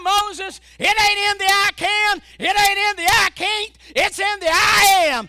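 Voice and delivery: loud high shouted command; God commanding. Acted. God telling Moses to go, shouted.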